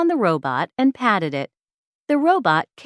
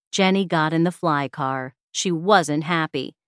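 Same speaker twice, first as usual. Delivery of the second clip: usual speaking voice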